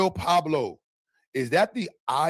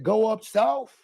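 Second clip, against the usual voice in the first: put-on country accent; 7 semitones higher, much slower than usual. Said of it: "He said".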